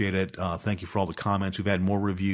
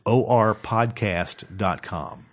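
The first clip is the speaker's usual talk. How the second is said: slow, spelled-out delivery; slow and deliberate; much slower than usual. Shown as a demonstration. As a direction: say it slowly. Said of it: "You gotta say it kinda slow".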